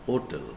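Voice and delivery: "hotel" said as "otel", starting on a vowel; silent H. Shown as a demonstration. Teacher explaining the use of an, "hotel" with silent H. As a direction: leave the H off "hotel". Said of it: "because of that reason".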